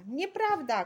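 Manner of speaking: high, loud retort; outraged mother crying out; shouted contradiction. A narrator's reading. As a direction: shout the accusation down. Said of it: "krzyknęła mama Doroty".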